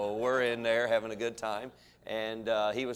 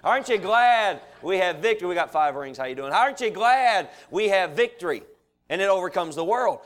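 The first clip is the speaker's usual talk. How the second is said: high, loud repeated appeal to the crowd; rousing preaching; 6 semitones higher, 6 dB louder than usual. Real, not acted. Preacher rallies church over victory in the world, rousing. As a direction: preach it with mounting insistence.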